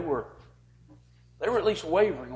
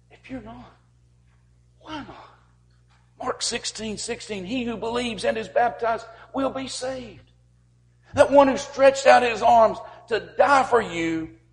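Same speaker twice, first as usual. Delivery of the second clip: much higher, pleading preacher call; impassioned appeal; 11 semitones higher, 10 dB louder than usual. Acted. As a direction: reach out and plead with them. High pleading invitation.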